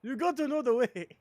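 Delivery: high, loud, insistent mock African accent; Ugandan Knuckles impression. Streamer escalating a meme quote, loud mock African accent. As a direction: press harder in the meme's African accent. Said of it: "when they get more and more vicious".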